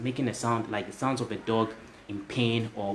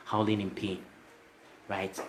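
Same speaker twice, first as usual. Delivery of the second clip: normal talking voice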